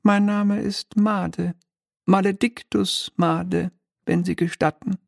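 high, mannered little devil voice; a smug minor demon, lightly shrill and polite; oily demon clerk. Acted. Demon Made gives his name.

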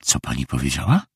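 low, hushed, shaken question; subdued, unsteady questioning; trembling voice. A narrator's reading. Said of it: "Jej głos drżał".

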